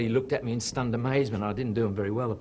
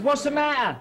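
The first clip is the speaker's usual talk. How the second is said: high, loud worried whale; much higher whale asking; 7 dB louder than usual. Acted. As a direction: call out to the sick squid. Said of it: "and the whale says".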